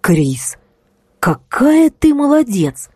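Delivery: high, slow delighted exclamation; exclaiming. A narrator's reading. Margo praising Kris, exclaiming delightedly. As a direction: gush with praise. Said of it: "воскликнула Марго".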